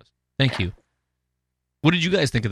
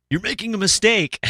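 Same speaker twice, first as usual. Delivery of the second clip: high-pitched acted warning; Gus; 10 semitones higher than usual. Gus warns Lorne as he gets let go, high mock warning. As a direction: call it out in a raised pitch. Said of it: "Gus is in the background".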